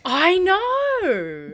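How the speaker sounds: high, loud, delighted squeal; excited. Real, not acted. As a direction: gush with excitement. Excited agreement.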